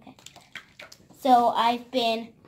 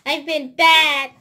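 shout; 3 semitones higher, 13 dB louder, slower than usual. Pretend. Reacting to coal.

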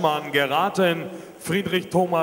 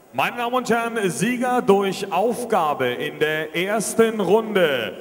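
boxing ring announcer; 8 semitones higher, 4 dB louder than usual. Acted. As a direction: stretch the name out for the crowd.

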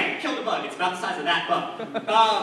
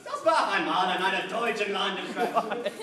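German. Acted. Comic offers the crowd a sample opening, in German. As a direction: joke opener delivered in German.

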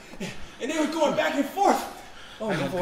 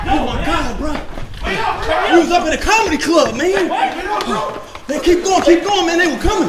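frantic; 4 semitones higher, 9 dB louder than usual. Acted. Frantic, fleeing.

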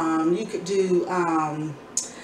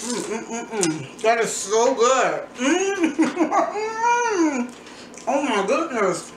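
blissful mmm, slow and high; savoring delicious food; 4 semitones higher, much slower than usual. Real, not acted. Tasting her own pizza.

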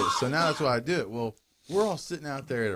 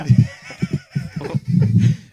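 big laugh; 11 dB louder than usual. Real, not acted. Bursts out laughing.

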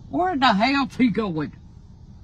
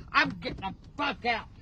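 ordinary talk, nothing marked

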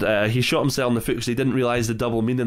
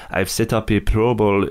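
put-on a self-admitted weak German accent, a bit lower; lightly put-on German accent imitating the director; bad German accent; 3 semitones lower than usual. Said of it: "I'm not very good at doing a German accent".

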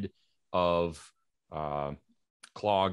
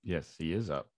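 ordinary delivery

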